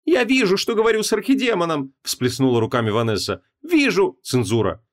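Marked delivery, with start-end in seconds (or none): 0.0-1.9 high-pitched indignant exclamation; exasperated wife. A narrator's reading. Wife snapping back at archdemon, exasperated. 3.6-4.2 high-pitched indignant exclamation; exasperated wife. A narrator's reading. Wife snapping back at archdemon, exasperated.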